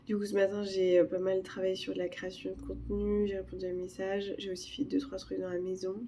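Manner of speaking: ordinary talk, nothing marked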